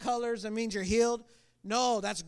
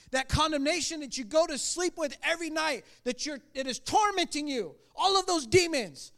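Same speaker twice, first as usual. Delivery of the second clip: impassioned plea, pitched high; pleading; 7 semitones higher than usual. Retold or imitated. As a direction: plead urgently, retelling it with full conviction. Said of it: "I'm pleading with this guy".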